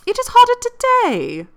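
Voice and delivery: put-on high, loud, snippy young lady; a high, loud sassy comeback; pert retort. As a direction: toss the line off sharply and high. Emma's pert retort in dialogue.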